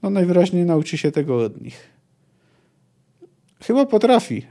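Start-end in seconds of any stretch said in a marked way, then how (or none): 3.6-4.4 a toothy grin in the voice, pitched up high; grinning. Acted. Kejlech grinning at the others about the girl. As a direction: say it through a wide grin. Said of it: "wyszczerzył zęby Kejlech".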